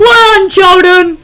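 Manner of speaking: high, loud sudden yell; startled scream. Real, not acted. Player screams the friend's name at a jump scare. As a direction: scream out in shock.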